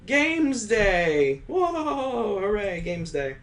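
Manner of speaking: high, laughing cheer; a class whooping with delight; excited cheer. Acted. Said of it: "Everyone shouts out in chorus".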